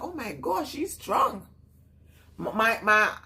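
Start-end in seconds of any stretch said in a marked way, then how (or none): 0.0-1.5 retold surprise.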